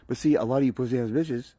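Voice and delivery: usual speaking voice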